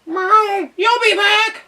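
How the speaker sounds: very high, loud meowing cat; a cross cat storming. A narrator's reading. Cat telling the departing duck off, high and loud. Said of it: "stormed the cat".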